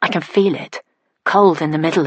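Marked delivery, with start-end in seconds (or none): none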